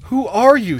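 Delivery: loud, high-pitched plea; desperate cry. A narrator's reading. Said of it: "she cried desperately".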